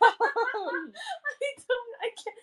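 high squeak through laughter; words dissolving into high giggles; helpless laughter. Real, not acted. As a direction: give up, laughing too hard to speak. Breaking down laughing.

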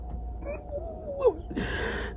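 long, high, trembling-free exhale while crying; long high sigh. Real, not acted. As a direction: sigh out the pain. Trying to calm down, crying.

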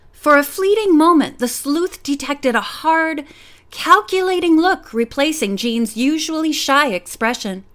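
plain reading voice

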